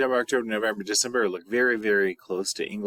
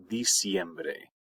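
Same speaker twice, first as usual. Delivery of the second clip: ordinary talk, nothing marked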